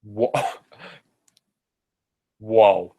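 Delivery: laughing, startled whoa. Real, not acted. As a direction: caught off guard by a noise. Startled by noise next door.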